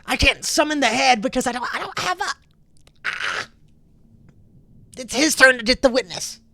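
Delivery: high, loud, whiny excuses; panicked protest. Acted. Pinned captive shifts blame to Holton, high panicked protest. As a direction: protest frantically, stumbling over the words.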